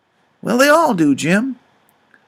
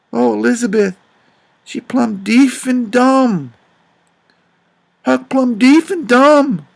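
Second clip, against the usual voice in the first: slow grief; 4 semitones higher, slower than usual. Retold or imitated. Grief over deaf daughter.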